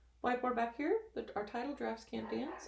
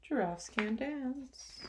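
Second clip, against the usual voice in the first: ordinary delivery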